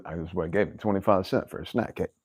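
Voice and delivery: ordinary talk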